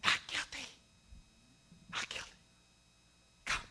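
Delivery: soft unvoiced whisper, tender verdict; hushed proclamation. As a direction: breathe the verdict over them gently. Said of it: "by proclaiming over you".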